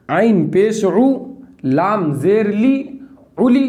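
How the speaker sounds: very slow, clear syllables; slow spelling drill. Shown as a demonstration. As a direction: spell it out for learners. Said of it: "पहले दो को मिलाना है".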